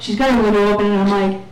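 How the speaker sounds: ordinary delivery, nothing marked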